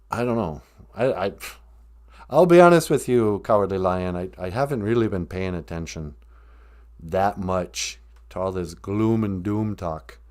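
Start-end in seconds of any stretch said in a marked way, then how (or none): none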